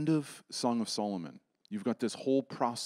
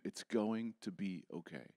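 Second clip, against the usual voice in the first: softer, gentle reassurance; quiet comforting voice of Jesus; soft whisper of comfort; 3 semitones lower, 9 dB quieter than usual. Acted. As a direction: hush it like a whispered promise. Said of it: "listening to him whisper to you".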